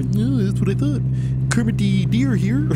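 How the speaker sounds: smug little frog puppet; Kermit the Frog voice. Acted. Gamer voices the deer as Kermit, smug. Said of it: "the Kermit the Frog voice".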